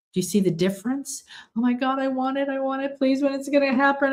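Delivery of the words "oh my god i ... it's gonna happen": high, breathless craving; high-pitched mock desperate pleading; mock desperation. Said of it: "that's desperation".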